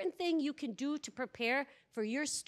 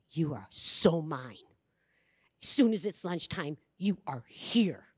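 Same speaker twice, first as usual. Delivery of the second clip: stern warning; 6 semitones lower, 4 dB louder than usual. Retold or imitated. Retreat leader to two misbehaving boys, stern warning. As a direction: lay down the law, drawing out each word.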